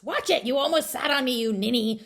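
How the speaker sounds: high, loud scolding yell. A narrator's reading. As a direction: bark at her for nearly sitting on you. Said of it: "the voice yelled".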